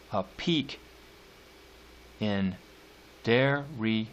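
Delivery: slow, measured poetry recital; drawn-out reading of a sonnet; poem reading. Acted. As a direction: lean on each stressed beat, slowly.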